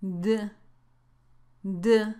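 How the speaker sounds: "D" as the bare consonant sound, not the letter name; a short, low voiced "D" sound; ed ending sound. Shown as a demonstration. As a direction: model just the sound of "D". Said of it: "la terminación ed se pronuncia así".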